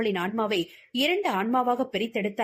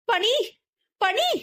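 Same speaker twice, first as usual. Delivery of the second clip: short urgent calls of a name; calling out; 12 semitones higher, 3 dB louder, slower than usual. A narrator's reading. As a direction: call out to her through the car window. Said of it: "கத்தியதை".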